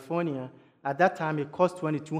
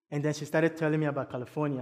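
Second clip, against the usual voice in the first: ordinary talk, nothing marked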